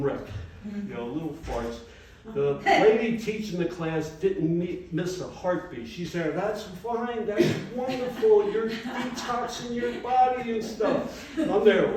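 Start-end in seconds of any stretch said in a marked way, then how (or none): 6.3-11.3 higher, slow soothing; a hint of a serene instructor; lightly voiced yoga teacher.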